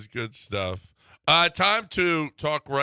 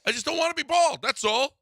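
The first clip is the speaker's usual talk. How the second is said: high-pitched mock plea; pleading balding man. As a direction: plead it up high like a whiny customer. Ad acts out a balding customer.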